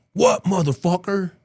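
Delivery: high, loud, drawn-out challenge; belligerent reply. Retold or imitated. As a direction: imitate an irritated tough guy yelling back. Imitates enforcer answering the call.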